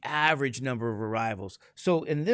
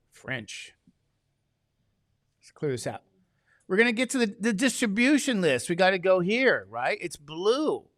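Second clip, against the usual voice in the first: normal talking voice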